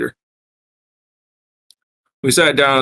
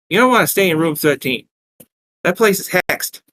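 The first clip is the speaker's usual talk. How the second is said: raised Brooklyn barmaid voice; Brooklyn accent; 6 semitones higher than usual. Acted. Barmaid warning guests about room thirteen, Brooklyn accent. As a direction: warn them in a Brooklyn accent. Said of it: "asked in a heavy Brooklyn accent".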